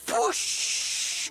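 breathy whooshing noise; whoosh sound. Acted. Man acting out the spa ride, whooshing. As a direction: mimic air rushing past.

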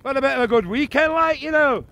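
hammed-up Scottish accent; terrible Scottish accent. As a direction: fake Scottish accent, fast and chirpy. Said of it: "That's a terrible Scottish accent".

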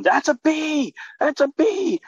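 high-pitched mock yell of alarm; retold panicked yell. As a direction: yell it as the alarmed crew member. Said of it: "starts yelling".

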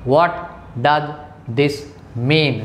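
each word split by pauses. Shown as a demonstration. Teacher dictating the question one word at a time. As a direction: pause after every word. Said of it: "Write it।".

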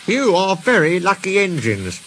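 lightly voiced cheerful engine; friendly storybook engine. A narrator's reading.

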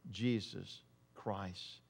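hushed. Acted. Preacher naming Jesus Christ to the church, hushed and slow. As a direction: land the name gently and slowly. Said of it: "sermon point landed softly".

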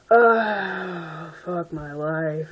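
long groan, then slow words; slow, defeated cringe; exasperated groan. Real, not acted. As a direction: groan in despair at it. Cringes at own writing.

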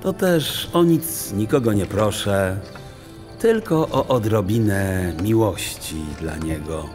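usual speaking voice

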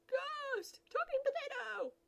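very high, loud scream; frightened shriek. Acted. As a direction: shriek at the ghost in terror. Screaming at ghost.

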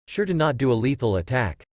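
plain reading voice

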